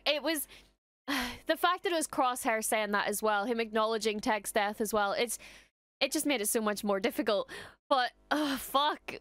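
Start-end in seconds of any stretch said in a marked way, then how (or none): none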